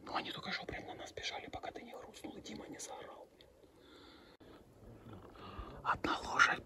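soft steady whisper; whispering while hiding. Real, not acted. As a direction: whisper so the horses stay put. Whispering near horses.

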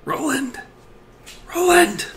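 high, hammed-up cry of "Roland!"; mock anguished call. A fan crying out to the character, hammed-up call. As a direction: wail the name like a stage tragedy.

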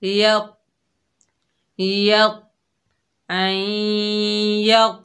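य with a heavy, strong ta merged in; thick, strong य; heavy sound. Shown as a demonstration. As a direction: say य firmly and full. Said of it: "मोटा अदा होगा".

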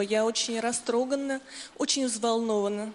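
ordinary delivery

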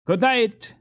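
put-on all the boys calling farewell; cried goodnight. A narrator's reading. The skaters calling goodnight to each other, loud. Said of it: "cried one and all".

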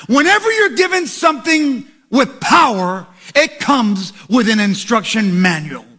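loud, rising preacher's call; preaching. Acted. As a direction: preach it hard to the congregation. Preaching at full force.

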